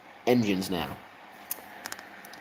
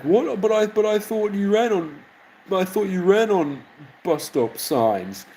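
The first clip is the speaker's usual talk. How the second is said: high, goofy confused engine voice; dim Samson; 9 semitones higher than usual. Pretend. Samson defending his bus stop sign, high dopey voice. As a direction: play it high and dopey, baffled.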